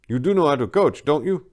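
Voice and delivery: doubtful groundskeeper. Acted.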